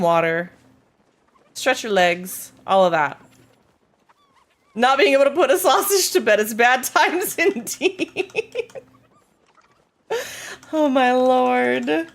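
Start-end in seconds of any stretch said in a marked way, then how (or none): 4.7-12.1 amused mock lament. Real, not acted. Amused, mock-dramatic reading.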